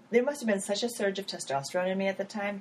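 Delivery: ordinary delivery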